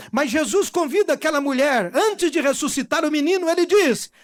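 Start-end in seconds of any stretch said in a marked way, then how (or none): none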